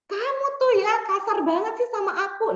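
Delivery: high-pitched, indignant accusation; acted-out finger-pointing complaint; accusing. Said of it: "kayak nuduh orang itu".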